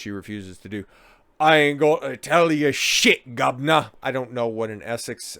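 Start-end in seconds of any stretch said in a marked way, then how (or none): 1.4-3.9 cockney, slow, defiant; a cockney drunk refusing; cockney accent. Retold or imitated. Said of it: "grab bag of English accents".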